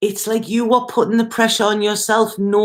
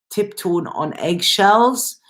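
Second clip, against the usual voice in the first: normal talking voice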